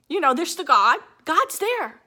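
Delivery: clinging to God, voice lifting; emotional. Real, not acted. Speaker tearfully insisting God is there, high and loud.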